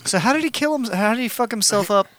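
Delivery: ordinary talk, nothing marked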